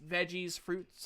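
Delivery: ordinary delivery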